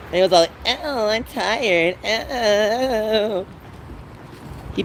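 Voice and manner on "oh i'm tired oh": slow, drowsy sigh of a lazy brother; mock lazy tired voice. Brother mimicked as too tired to help. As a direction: drag it out like a sleepy slacker. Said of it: "So he's all lazy, slothily".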